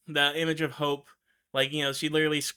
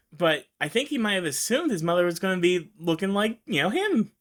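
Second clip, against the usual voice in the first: ordinary talk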